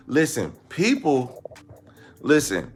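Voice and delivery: ordinary talk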